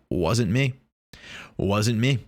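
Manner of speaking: usual speaking voice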